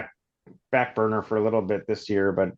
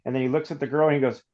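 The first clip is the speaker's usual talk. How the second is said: usual speaking voice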